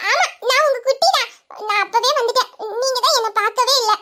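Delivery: put-on high, childish voice; little child. Child telling mother she went unnoticed, childlike. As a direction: complain sweetly like a small kid.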